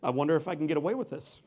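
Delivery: normal talking voice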